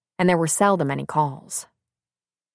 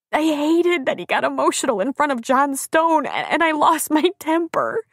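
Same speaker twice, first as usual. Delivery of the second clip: high-pitched tearful outpouring; sobbing; 10 semitones higher than usual. A narrator's reading. Crying woman explains fight to friends, sobbing. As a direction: pour it out in acted sobs. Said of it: "her words caught in a sob".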